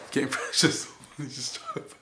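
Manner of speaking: bursts of real laughter through words; cracking up mid-sentence; laughing. Real, not acted. Laughing at Cena's beatdown.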